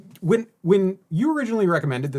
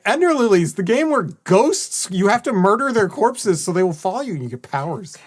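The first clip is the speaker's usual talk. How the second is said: mocking recommendation; 7 semitones higher, 6 dB louder than usual. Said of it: "and you're like".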